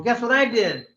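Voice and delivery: high-pitched, teasing build-up to news; eager announcement. Retold or imitated. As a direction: tease the family with your news.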